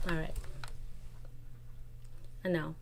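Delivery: ordinary delivery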